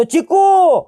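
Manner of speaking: high shouted name; loud shout. Acted. Man yelling after the fleeing girl, loud shout. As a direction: shout her name as she drives off. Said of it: "Vikrant जोरात ओरडतो".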